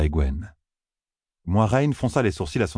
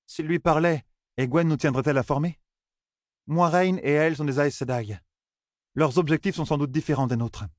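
high-pitched elder questioning loyalties; high, steady character voice of doubt; distrustful matriarch; 8 semitones higher than usual. Acted. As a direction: voice a direct matriarch airing doubts, pitch well up. Voices Melaine doubting the outsiders.

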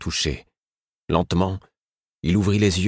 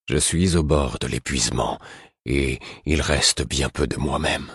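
a lightly lowered, worn-out king's voice; understated, weary lower king; weary Verity; 3 semitones lower than usual. A narrator's reading. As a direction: speak like a king at the end of his strength. Verity confesses his exhaustion.

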